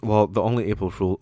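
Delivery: normal talking voice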